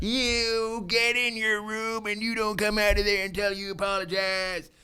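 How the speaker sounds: very high, drawn-out caricature of a nagging wife; hammed-up high-pitched wifely telling-off; mock scolding wife. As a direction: scold in a slow, sustained falsetto. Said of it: "That is not how my wife talks".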